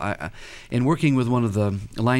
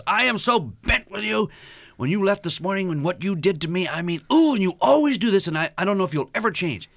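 upset wife venting; 8 semitones higher than usual. Retold or imitated. Wife scolding husband at the door, high mock upset. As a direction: vent the hurt at him, pitched high. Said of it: "more harsh words".

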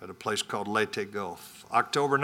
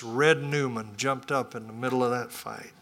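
ordinary talk, nothing marked

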